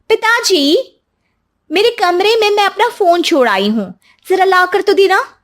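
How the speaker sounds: put-on high, curt order to the old man; bossy daughter-in-law. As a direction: command him loudly from above.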